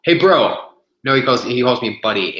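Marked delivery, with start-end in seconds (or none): 0.0-0.7 loud, high imitation of a gym bro; mock bro greeting. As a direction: call out Hey, bro like a cheery stranger. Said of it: "he goes".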